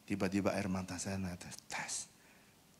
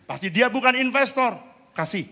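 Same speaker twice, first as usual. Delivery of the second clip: much higher and louder, a decisive boss; boss's loud order; 12 dB louder than usual. Retold or imitated. Boss overrules the staff with a loud, high call. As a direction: bark out the boss's verdict. Boss decides in retold story.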